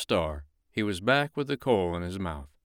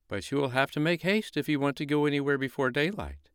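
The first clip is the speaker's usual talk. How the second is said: higher distant moon voice; 6 semitones higher than usual. Acted. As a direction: urge them to hurry. Moon warns.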